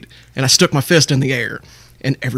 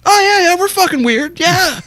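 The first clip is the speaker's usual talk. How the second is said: eager agreeing; 14 semitones higher than usual. Retold or imitated.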